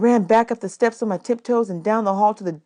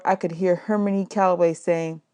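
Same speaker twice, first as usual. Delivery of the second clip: ordinary delivery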